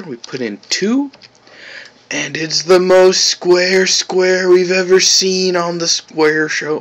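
high, loud showman hype; excited. Real, not acted. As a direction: announce it like a game show. Hyping the square result.

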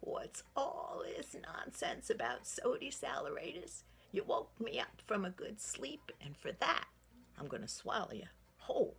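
a mildly gruff bear roused from sleep; a touch of a cross bear; grumpy bear. Acted. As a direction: growl it at the boy dangling in the air. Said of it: "and he was grumpy".

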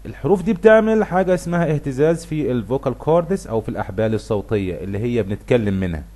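usual speaking voice

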